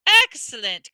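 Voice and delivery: loud, pleased cry; cried. A narrator's reading. Teacher praising the class, crying out. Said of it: "cried Professor Lupin".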